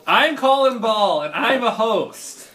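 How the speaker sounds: slow, loud, proud announcer intro; host intro voice. Shown as a demonstration. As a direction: announce yourself like a show host. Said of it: "I was bad at the cue there".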